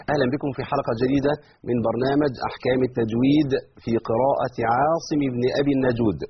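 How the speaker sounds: normal talking voice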